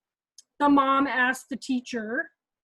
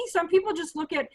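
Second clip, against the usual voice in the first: ordinary talk, nothing marked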